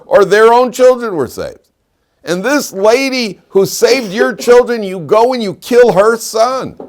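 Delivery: high, loud, emphatic outrage; impassioned preaching. Real, not acted. Teacher decrying the killing of Miriam's son, impassioned preaching. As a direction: preach it indignantly at full force.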